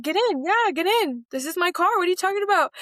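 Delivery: urging the niece in, thrilled; excited insistence. Retold or imitated. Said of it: "And I was like".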